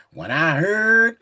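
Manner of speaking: slow, weighty King James reading; measured, booming Bible reading; solemn scripture reading. Acted. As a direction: read it like a preacher at the pulpit. Reads scripture aloud.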